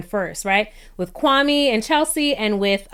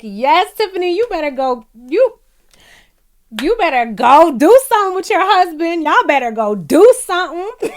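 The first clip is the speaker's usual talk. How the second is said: cheering tease; 7 semitones higher, 5 dB louder than usual. Retold or imitated. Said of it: "And I'm like".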